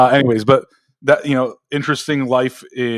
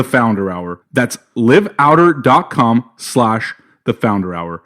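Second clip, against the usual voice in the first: ad read; 3 dB louder than usual. Acted. Host gives listeners the sponsor's web address, ad read. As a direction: sell the offer, punching key words.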